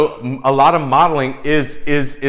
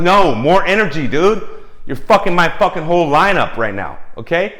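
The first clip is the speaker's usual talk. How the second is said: demanding crew; 5 semitones higher, 5 dB louder than usual. Retold or imitated. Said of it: "They're like".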